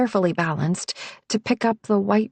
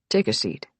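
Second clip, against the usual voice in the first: much lower, calm man's voice; low male voice; 9 semitones lower than usual. A narrator's reading. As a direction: settle her down, low and calm. Nick's low voice.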